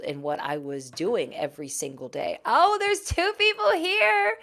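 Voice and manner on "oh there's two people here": high, delighted gasp at viewers arriving; thrilled surprise; excited squeal. Real, not acted. Host sees viewers join, excited high squeal.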